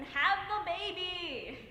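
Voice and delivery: high, loud, very drawn-out plea; exasperated, slow-motion order; emphatic urging. Acted. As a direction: stretch every word as you call it out. Urging a soap opera character.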